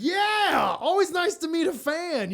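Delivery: hammed-up sarcastic thanks, pitched high; sarcastic reply. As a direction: fake politeness to a heckler.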